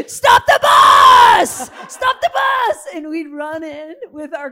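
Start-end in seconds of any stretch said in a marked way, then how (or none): 0.1-2.9 high, loud, drawn-out yell; retold excited yell. Teammates spotting a Dunkin' from the bus, excited yell. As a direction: shout at the driver to pull over.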